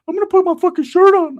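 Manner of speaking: high, loud cartoonish cousin voice; cousin Ricky voice. Cousin Ricky pulling him aside, in a high squeaky voice.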